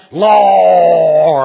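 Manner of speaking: hammed-up preachy cry on "Lord"; preacher's wailing Lord. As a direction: howl "Lord" like a revival preacher. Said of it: "making all kinds of funny sounds".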